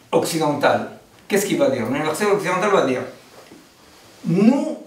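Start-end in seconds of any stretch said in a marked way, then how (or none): none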